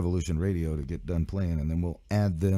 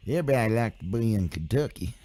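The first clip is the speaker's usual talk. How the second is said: joking put-on Kentucky twang; Kentucky accent; 4 semitones higher than usual. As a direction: do a playful, rough Kentucky accent. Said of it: "That was my Kentucky accent".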